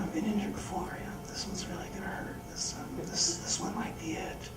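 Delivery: hushed, breathless whisper to himself; barely voiced, bracing for impact; whispered inner thoughts. Snowboarder thinking to himself mid-fall, whispered.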